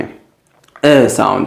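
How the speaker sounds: "अ" drawn out slowly, lips spread; long bird vowel. Shown as a demonstration. Teacher stretching the bird vowel for students. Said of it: "इसको आपने length देना है".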